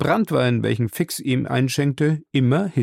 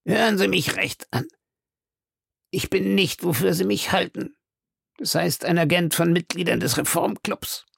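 earnest Fix; 7 semitones higher than usual. A narrator's reading. Fix confiding to Passepartout, lightly voiced detective. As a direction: confide it seriously.

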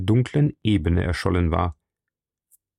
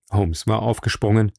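ordinary delivery

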